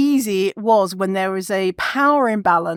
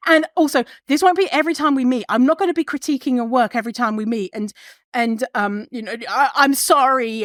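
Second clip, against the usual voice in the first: higher, rushed nervous apology; anxious apologizing; 4 semitones higher, faster than usual. Retold or imitated. Over-apologizing to the doctor after giving feedback, high and rushed. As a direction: gabble the apology to appease him. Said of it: "I was just like".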